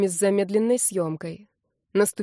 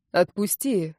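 plain reading voice

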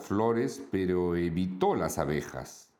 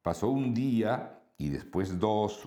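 ordinary delivery

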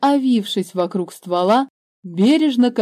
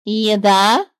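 louder bird voice; the bird suggesting a word; bird offering; 6 dB louder than usual. A narrator's reading. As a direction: offer it hopefully. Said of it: "предложила птица".

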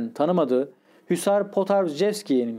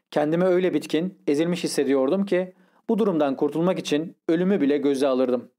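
plain reading voice

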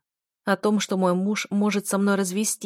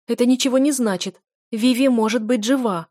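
higher, louder flustered hope; a wife grasping at hope, higher and louder; flustered, hopeful; 4 semitones higher, 6 dB louder than usual. A narrator's reading. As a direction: blurt it out in sudden alarmed hope. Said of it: "всполошилась я".

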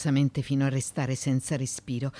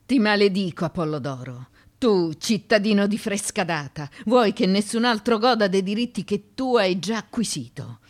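louder, hurling a curse; furious curse; 4 semitones higher, 7 dB louder than usual. Acted. Woman cursing Apollo d'Oro, loud furious damnation. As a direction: spit the curse at him, loud and bitter.

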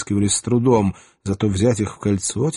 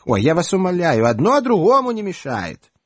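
high, breezy Odessa shrug; Odessa accent; 8 semitones higher than usual. Acted. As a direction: wave it off, Odessa style. Odessa woman brushing off.

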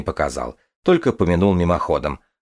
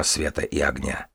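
ordinary delivery, nothing marked

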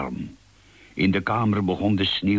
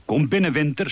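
owl's friendly welcome; 7 semitones higher, faster than usual. Acted. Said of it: "zei Uil".

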